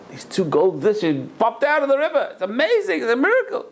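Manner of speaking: high, thrilled report of a miracle; excited. Retold or imitated. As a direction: burst out with amazement. Servant reports miracle to rabbi.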